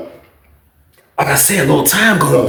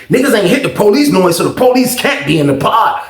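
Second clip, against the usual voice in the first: ordinary talk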